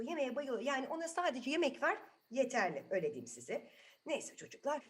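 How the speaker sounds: plain reading voice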